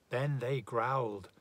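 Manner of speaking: ordinary delivery, nothing marked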